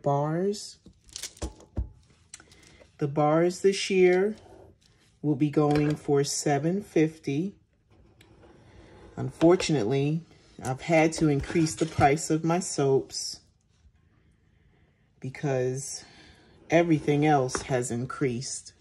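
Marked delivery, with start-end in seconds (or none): none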